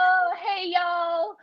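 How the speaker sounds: very high-pitched mock Southern greeting; playful Southern drawl. Author tries out a South Carolina y'all, playful Southern accent. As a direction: chirp it in a put-on Southern accent.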